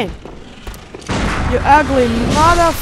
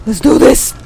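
"Let's do this" louder, with a rise on "do"; pumped up; 4 dB louder than usual. Shown as a demonstration. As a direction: fire yourself up, punch "do".

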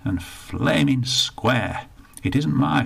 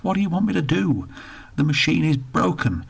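pitched-up exasperated outburst; exasperated attendant; 8 semitones higher, 3 dB louder than usual. Acted. Defeated attendant to Bertie, exasperated. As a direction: throw your hands up with it.

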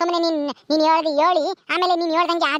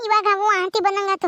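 ordinary delivery

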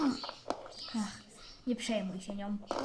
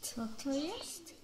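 whisper; 5 semitones lower, 7 dB quieter than usual. Real, not acted. Secret whispering between kids.

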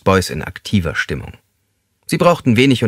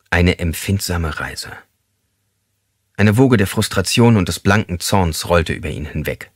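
ordinary delivery, nothing marked

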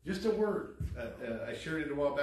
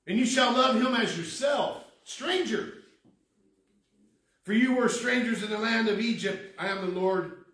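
raised, louder scripture preaching; forceful preaching; 8 semitones higher, 9 dB louder than usual. Real, not acted. Preacher urging the congregation to love strangers, emphatic preaching. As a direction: drive the verse home from the pulpit.

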